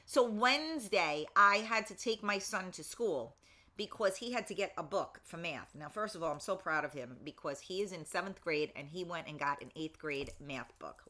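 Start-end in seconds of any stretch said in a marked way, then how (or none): none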